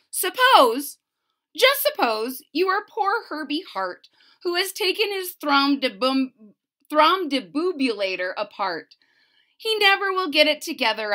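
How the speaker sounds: lively storybook read-aloud; bouncy picture-book reading voice; reading to children. Acted. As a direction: bring the rhymes to life for little listeners.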